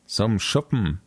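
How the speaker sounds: plain reading voice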